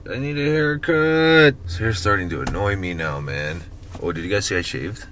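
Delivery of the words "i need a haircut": higher, louder, drawn out; whiny exaggerated complaint. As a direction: whine it like you're fed up.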